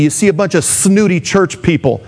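usual speaking voice

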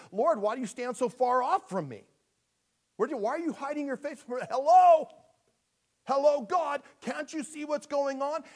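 high, loud pleading cry; desperate cry to God. Acted. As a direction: shout up to heaven as if ignored. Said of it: "as he's just saying".

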